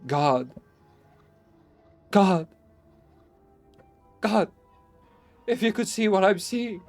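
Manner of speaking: voiced as a frantic shout despite the whisper; terrified cries. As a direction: cry out in horror from below. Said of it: "now called from below in a shaky whisper".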